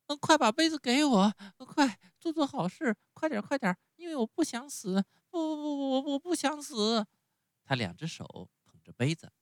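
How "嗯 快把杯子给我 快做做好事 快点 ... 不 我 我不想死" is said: much higher, louder desperate pleading; panicked shouting. A narrator's reading. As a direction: beg frantically not to die. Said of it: "大声叫起来".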